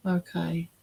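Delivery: ordinary talk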